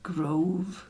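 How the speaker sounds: breathy whisper to herself; hushed trial word. Real, not acted. Trying a word under breath.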